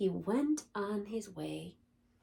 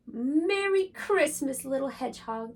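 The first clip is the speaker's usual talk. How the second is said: joyful shout; 5 semitones higher, 9 dB louder than usual. Acted. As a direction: cheer it together. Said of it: "they all cried".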